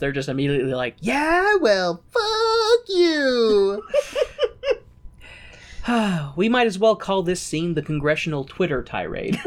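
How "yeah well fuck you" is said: very high, drawn-out comic jeer; hammed-up squealing comeback; mock retort. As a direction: stretch the insult way out, high-pitched. Acts out congressmen's instant retort.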